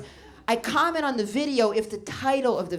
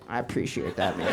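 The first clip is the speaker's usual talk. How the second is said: lower, laid-back dude voice; cool guy voice; 12 semitones lower than usual. Pretend. Comic thanking jerking fan, low dude voice. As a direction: lightly play a chill bro. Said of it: "I'd be like".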